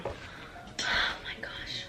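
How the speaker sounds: soft, slow embarrassed whisper. Real, not acted. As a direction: whisper it in cringing dread. Dreading the embarrassing entry.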